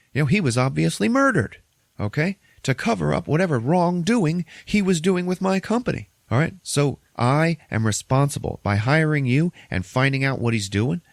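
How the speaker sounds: high, emphatic rebuke; indignant tirade. Retold or imitated. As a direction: act out an outraged boss laying blame. Retold boss angrily blames the accountant.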